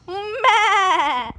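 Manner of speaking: loud high bleat; goat bleat. Pretend. Child playing goat, loud high meh.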